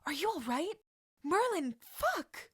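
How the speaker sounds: high, loud panicked voice; rising panic over a fallen friend; terrified. Acted. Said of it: "with a terrified look on his face".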